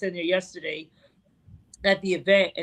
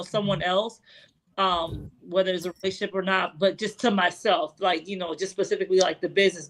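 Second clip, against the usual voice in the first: normal talking voice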